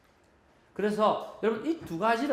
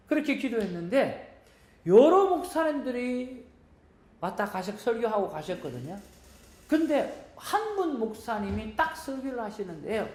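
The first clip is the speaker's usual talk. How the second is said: ordinary talk, nothing marked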